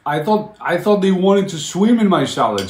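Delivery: high, loud, incredulous protest; exasperated. Real, not acted. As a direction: plead your innocence loudly. Exasperated explanation to chat.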